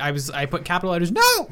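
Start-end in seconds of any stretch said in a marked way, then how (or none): 1.1-1.5 high, loud mock "No!"; mock scream. Retelling a shocked reply to a friend's Lost plan, mock scream. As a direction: yell the no in capital letters. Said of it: "I scream texted".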